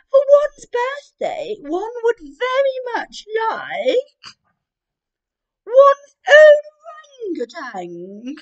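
very high, loud and slow grand announcement; regal queen. Acted. Queen declaring her birthday wish to family: high, loud, slow. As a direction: proclaim it like royalty.

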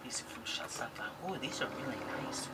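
fast awed whisper. Real, not acted. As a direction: breathe it in awe. Whispering over gift.

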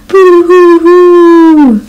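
high, slow hammed-up boo-hoo wail; boo-hoo sobbing. Hurt bird bawling to his friends, hammed-up high boo-hoo sob. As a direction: wail it like a cartoon crybaby. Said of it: "cried Bird's friends together".

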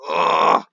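loud, voiceless "ugh" of frustration; loud exasperated groan. Real, not acted. Gamer fed up with his team, loud groan. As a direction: blurt out a harsh groan.

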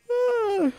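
big sleepy yawn; long tired yawn. Real, not acted. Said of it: "Sorry for the yawn".

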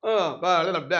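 a soft, mumbling dodge of the question, mimicked; mock evasive mumble. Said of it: "అంటున్నాడు".

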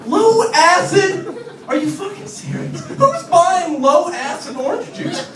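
can't believe this exists; high, slow disbelieving rant; incredulous outrage. Real, not acted. Outraged at juice label.

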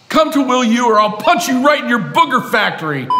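loud, high mock threat. President threatening viewers in a promo, loud mock bully. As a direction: bark it like a playground bully.

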